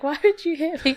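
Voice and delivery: a hint of hurt tears in it; mock teary whine. Crazy guy after being slapped, mock teary whine. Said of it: "He's like".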